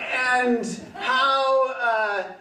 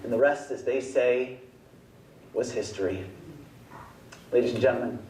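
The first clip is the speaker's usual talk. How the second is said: podium speech; 10 semitones lower, faster than usual. Acted. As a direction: speak like a pompous awards presenter. Presenter in a grand podium persona.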